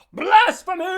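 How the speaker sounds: loud, high mock-outraged yell; imitated furious outburst; outraged shout. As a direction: bellow it like a hammed-up zealot. Acting Jacob's outburst, very loud.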